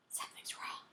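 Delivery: hushed, secretive whisper; very soft, confidential warning. A narrator's reading. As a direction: whisper it to a friend. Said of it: "she whispered to Eric".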